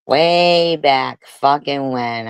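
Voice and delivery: WAY stretched long and loud; slow, pointed stress on "Way"; drawn-out emphasis. Real, not acted. Arguing back at an accuser, drawn-out emphasis on "Way". Heated argument, drawing out for emphasis.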